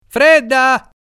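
put-on high, loud boyish shout from the rocks; boy's high call. Boys shouting to Mariassa in the water, high and loud. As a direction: call out like a curious kid.

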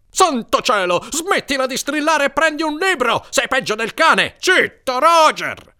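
high, irritated shouting; exasperated scolding. A narrator's reading. Brother tells shrieker and dog to shut up, shouting.

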